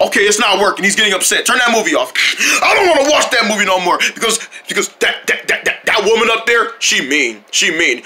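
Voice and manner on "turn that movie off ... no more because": ordinary talk, nothing marked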